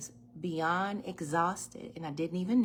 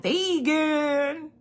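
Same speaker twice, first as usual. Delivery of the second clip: mock-puzzled customer, higher and louder; slow drawn-out mock disbelief; 8 semitones higher, 9 dB louder, much slower than usual. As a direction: stretch out gluten-free like a baffled shopper. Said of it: "People like".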